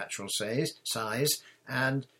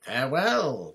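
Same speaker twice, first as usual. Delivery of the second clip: loud, ringing farewell, pitched up; knight's cry; 4 semitones higher, 7 dB louder than usual. A narrator's reading. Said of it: "cried the knight".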